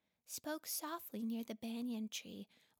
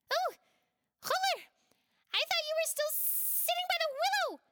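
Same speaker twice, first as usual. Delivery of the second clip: much higher, louder surprised cry; surprised exclaim; 11 dB louder than usual. Acted. Big Gauro is startled to find Chulur behind them, exclaiming. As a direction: caught off guard by a sneaky friend. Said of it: "Big Gauro exclaimed".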